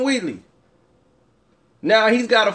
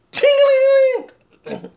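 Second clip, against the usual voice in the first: much higher, slow, stretched "Ching-a-ling!" coin sound; cartoon coin jingle; 4 dB louder, much slower than usual. Fighter mimicking coins knocked out of Conor's mouth, high sung jingle. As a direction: sing out a cartoon cash-register ring.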